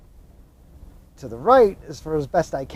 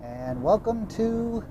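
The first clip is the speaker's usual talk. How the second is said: slow announcer; 6 semitones higher, 6 dB quieter, slower than usual. Pretend. Announcing Texas arrival.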